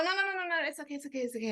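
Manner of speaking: high, soft, hurried placating; imitated apologetic cooing; mock soothing. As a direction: mimic frantic soothing in a small high voice. Mimics Geminis hurriedly soothing after hitting.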